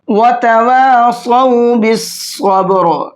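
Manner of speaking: slow, chanted tajweed recitation; Quranic recitation. Shown as a demonstration. Teacher chanting the verse for students, slow and melodic. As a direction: recite it slowly in melodic Arabic.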